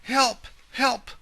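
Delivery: high, loud screams for help; cry for help. Acted. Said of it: "cried the grandmother".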